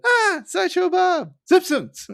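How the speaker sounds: high, slow shouted singsong; match the rhythm of the call; singsong shouting. Retold or imitated. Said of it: "he tried to match the rhythm".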